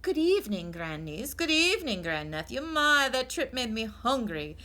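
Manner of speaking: formal grand greeting; Great Aunt Myra. Acted.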